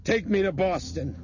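high, loud, furious shouting; frantic demand. A narrator's reading. Craig holds Bethany at gunpoint, frantic demand. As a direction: shout the order like a cornered gunman. Hostage taker makes demands.

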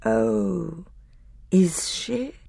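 sinister and hollow; grey terrible voice. A narrator's reading. Ghostly figure to the conspirators, hollow sinister voice. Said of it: "That grey and terrible voice".

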